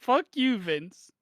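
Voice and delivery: mock furious yell. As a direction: scream it at the boss. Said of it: "he doesn't stop to catch a fucking breath".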